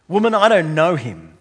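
loud, flat-out denial of knowing him; emphatic denial. Retold or imitated. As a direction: push him away with the words. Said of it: "Peter emphatically denies Jesus".

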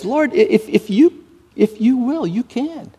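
high, stammering, fading at the end; halting plea. Acted. A humbled leper stammering his plea to Jesus. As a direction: stumble humbly into the request. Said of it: "this leper says".

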